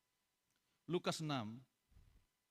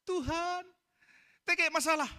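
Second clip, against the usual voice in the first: mock pleading believer; 14 semitones higher, 4 dB louder than usual. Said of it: "Bilang sama Tuhan".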